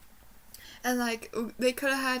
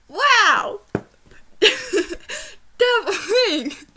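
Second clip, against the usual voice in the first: excited shout; 9 semitones higher, 11 dB louder, much slower than usual. Real, not acted. Reacting to a spoiler.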